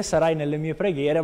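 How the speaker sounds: usual speaking voice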